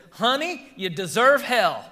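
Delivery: loud, drawling Alabama woman, imitated; high-pitched "honey" in an Alabama twang; thick Alabama accent. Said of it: "she had a thick Alabama accent".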